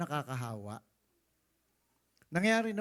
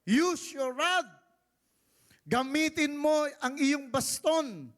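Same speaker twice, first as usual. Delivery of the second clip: high, loud, slow divine command; the Lord ordering Moses to use his rod; commanding God; 11 semitones higher, 6 dB louder, slower than usual. Acted. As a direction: proclaim it like thunder from heaven. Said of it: "sabi ng Lord sa kanya".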